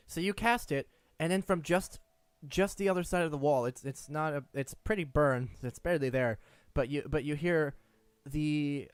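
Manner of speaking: ordinary delivery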